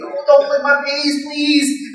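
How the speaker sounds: high, slow plea to a lamb; drawn-out high-pitched begging; comic pleading. Acted. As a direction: whine gently at an affectionate animal. Pleads with lamb licking him.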